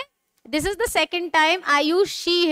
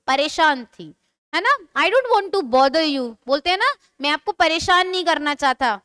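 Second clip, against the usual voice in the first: ordinary talk, nothing marked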